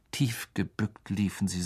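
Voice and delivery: ordinary delivery, nothing marked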